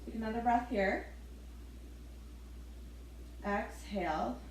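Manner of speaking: ordinary talk, nothing marked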